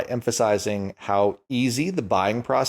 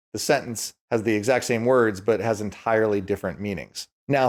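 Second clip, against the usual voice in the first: normal talking voice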